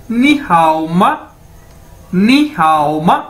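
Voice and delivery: नी हाओ माँ said slowly, syllable by syllable, rising at the end; slow Mandarin demo. Shown as a demonstration. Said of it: "ये इसकी pronunciation है". (धीरे-धीरे, हर शब्द अलग बोलते हुए "नी हाओ माँ")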